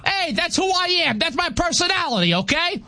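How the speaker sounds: aggressive. Real, not acted. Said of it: "don't be so aggressive over there".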